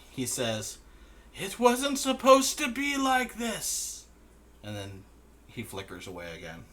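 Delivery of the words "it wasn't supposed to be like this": very high, slow, tearful lament; crying. Acted. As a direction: whimper it through tears. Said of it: "still crying".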